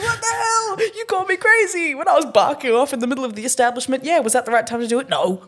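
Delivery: high, indignant rant; high exasperated rant with a crack; indignant outburst. Retold or imitated. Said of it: "And I'm like".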